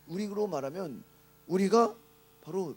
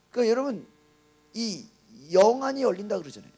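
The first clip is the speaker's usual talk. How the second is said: ordinary talk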